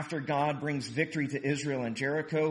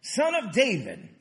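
high, louder cry in a reading; louder narrated shout; 6 semitones higher, 5 dB louder than usual. A narrator's reading. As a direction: call out higher and louder. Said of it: "But he only shouted louder".

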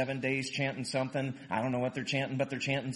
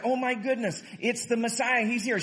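higher, excited retold cry; imitated crying out; 8 semitones higher than usual. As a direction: retell an excited cry, pitched high. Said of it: "And so he cries out".

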